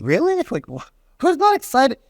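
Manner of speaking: high disbelieving squeak; incredulous. Retold or imitated. Agent reacting to the pregnant caller, high incredulous squeak. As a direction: sound baffled that anyone isn't thrilled. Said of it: "And he goes".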